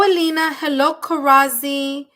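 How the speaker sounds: usual speaking voice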